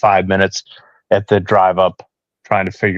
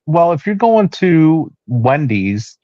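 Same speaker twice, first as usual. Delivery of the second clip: higher, slow sing-song wife imitation; drawn-out mimic of wife's suggestion; quoting wife; 6 semitones higher, slower than usual.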